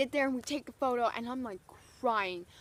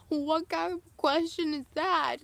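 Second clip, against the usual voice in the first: higher, louder mock disbelief; incredulous; 5 semitones higher, 5 dB louder than usual. Fan asked if she wants to meet [name], incredulous. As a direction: throw the question back, incredulous.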